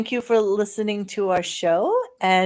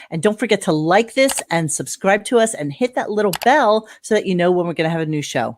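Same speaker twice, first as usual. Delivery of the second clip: announcer voice; 5 dB louder than usual. Acted. Recorded outro read.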